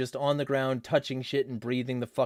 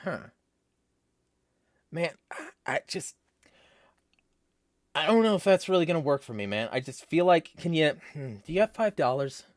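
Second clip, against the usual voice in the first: higher, halting tweaker begging for cash; 4 semitones higher than usual. Acted. Tweaker hitting someone up for five dollars, tweaker voice. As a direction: stop and start like a tweaker, some bits rushed. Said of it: "Do a tweaker voice".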